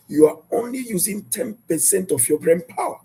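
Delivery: usual speaking voice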